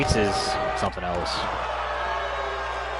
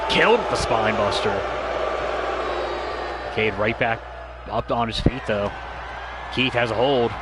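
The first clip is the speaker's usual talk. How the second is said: wrestling commentator; 2 semitones higher than usual. Real, not acted. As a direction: call the action as it happens. Live wrestling play-by-play.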